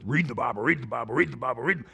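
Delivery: low, droning repetition; mock drudgery. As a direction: drone it over and over like a chore. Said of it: "it was such a drudgery".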